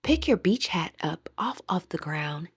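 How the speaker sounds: usual speaking voice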